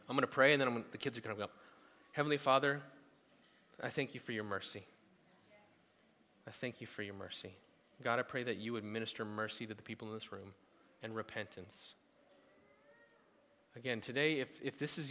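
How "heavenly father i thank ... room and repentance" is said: soft, hushed prayer voice. Real, not acted. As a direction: pray quietly over the room. Pastor prays over the room.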